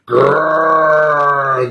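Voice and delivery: slow growled grrr. A narrator's reading. Narrator imitates a bear's growl for listeners. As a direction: roll out a bear growl.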